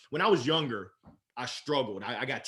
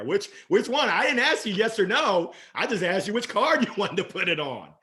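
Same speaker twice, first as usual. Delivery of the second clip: playful sales push; 6 semitones higher, 6 dB louder than usual. Shown as a demonstration. Salesman pushing back on no.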